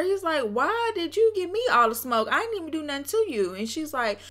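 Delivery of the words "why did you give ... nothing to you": high-pitched mock protest; retold indignant complaint, pitched up; imitated confronting. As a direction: confront her as the wronged party. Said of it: "he confronts her".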